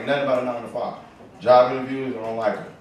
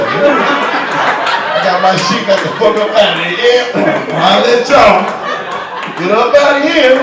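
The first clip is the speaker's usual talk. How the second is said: loud excited; 6 semitones higher, 8 dB louder than usual. Real, not acted. Excited retelling leaving job.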